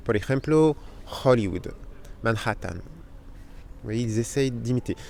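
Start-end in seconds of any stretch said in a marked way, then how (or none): 1.2-1.7 "Hollywood" opening on a throaty kh; Spanish-style aspirated H. Retold or imitated. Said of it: "ils font une sorte de r". 2.2-2.7 "Manhattan" with a throaty kh in the middle; Spanish-style aspirated H. Retold or imitated. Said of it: "ils font une sorte de r".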